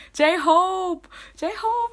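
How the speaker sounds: very high, excited name-chant; fan cheer. Acted. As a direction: squeal the idol's name like a fan.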